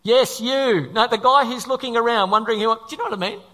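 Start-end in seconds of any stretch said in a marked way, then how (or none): 0.0-0.9 loud, hailing someone from above; booming mock voice from heaven; mock heavenly call. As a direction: call out to single someone out, playfully. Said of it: "a voice from heaven says".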